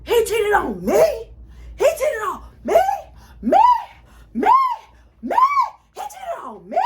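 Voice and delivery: high crying wail repeating he cheated on me; mock wailing. Telling best friend about the cheating boyfriend, hammed-up wailing. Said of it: "we just keep repeating one part".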